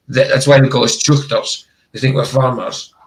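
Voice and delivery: ordinary delivery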